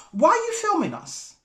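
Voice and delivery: high-pitched indignant challenge; retold confrontation. Confronting the woman filming from across the road, indignant. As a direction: demand an answer, pitch jumping up then falling. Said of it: "I confront her".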